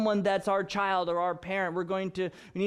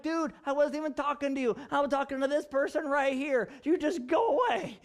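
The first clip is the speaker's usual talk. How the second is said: higher-pitched mock exasperation, brushing him off; exasperated retort; 7 semitones higher than usual. Preacher retelling bus station stranger, mock exasperated retort. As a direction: retell shooing off an angry stranger. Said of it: "And I'm like".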